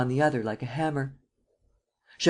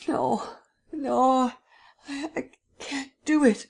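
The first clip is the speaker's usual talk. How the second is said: high, halting no; panicked refusal; 11 semitones higher, slower than usual. Acted. Young man refuses the prince in high panic.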